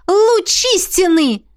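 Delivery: loud, high, slow cry of triumph; gleeful shout at finding the artefact; exultant. Acted. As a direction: hold the find up and rejoice. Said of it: "возликовала она".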